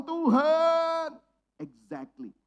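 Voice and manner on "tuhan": desperate cry to God. Pretend. Acting a desperate prayer cry.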